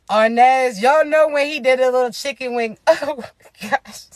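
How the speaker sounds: words giving way to real laughter; helpless amusement. Real, not acted. Host reminding viewers of a comedy bit, cracking up laughing. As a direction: crack up as you finish the sentence.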